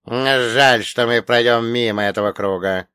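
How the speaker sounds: loud gruff villain; Chernomor voice. Acted. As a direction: joke like an old rogue.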